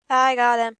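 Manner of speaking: loud, with I punched; triumphant shout. Real, not acted. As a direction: yell it in triumph.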